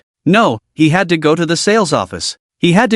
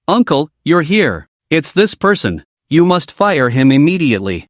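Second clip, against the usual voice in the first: ordinary delivery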